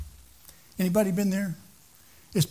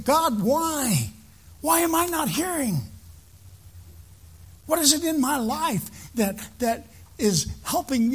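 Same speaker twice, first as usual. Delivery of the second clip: higher-pitched, pleading cry to the Lord; crying out to God; 5 semitones higher than usual. Acted. Believer on their knees asking God why, crying out. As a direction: plead upward, drawing out the question. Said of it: "you need to be crying out".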